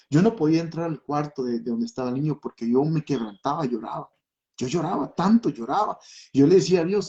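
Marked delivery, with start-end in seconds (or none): none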